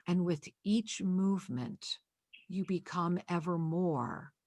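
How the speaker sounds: slow, soft prayer voice, sinking to a whisper at the end; spoken blessing. Acted. Healer blessing the caller on air, slow hushed prayer. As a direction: bless the listener gently and unhurriedly, like a closing prayer.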